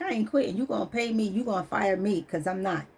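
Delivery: softly voiced, firm refusal; quiet defiance. Telling the job she won't quit, quietly defiant. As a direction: say it soft but stubborn. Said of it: "And I'm like".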